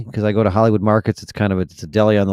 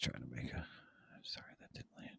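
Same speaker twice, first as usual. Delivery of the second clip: whispering; 11 semitones lower, 34 dB quieter than usual. Real, not acted. Joke flopped, quiet aside.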